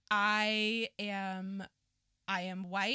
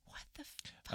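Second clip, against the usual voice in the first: very soft, voiceless whisper; whispered thought; 15 dB quieter than usual. Retold or imitated. Quoting own thought about this place, exasperated whisper. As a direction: whisper it in disbelief.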